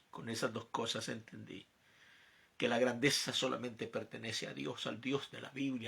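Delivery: normal talking voice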